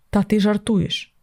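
ordinary delivery, nothing marked

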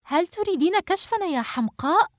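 high-pitched, edgy scolding; suppressed irritation. Acted. Samar fearing exposure by her friend, highly irritated. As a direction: rebuke her in a high, tense voice. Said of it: "سمر بالعصبية نفسها".

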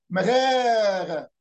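very slow stretched مغاغة; drawn out. Retold or imitated. Minya local answering the traveller, slowly drawn out. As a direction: drag مغاغة out long. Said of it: "بياخد وقت طويل قوي".

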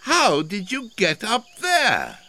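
high, loud, amazed question; astonished. A narrator's reading. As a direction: call it up in disbelief. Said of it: "gasped Elmer".

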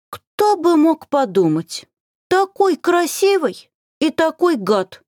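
high squeaky fairy voice, slow; pixie. A narrator's reading. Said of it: "пропищала феечка".

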